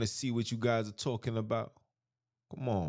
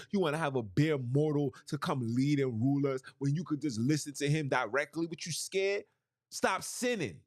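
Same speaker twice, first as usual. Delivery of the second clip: impassioned street sermon, rising and falling; full-force preaching; 4 semitones higher than usual. Acted. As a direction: preach it to the congregation with fire. Preaching with scripture readings.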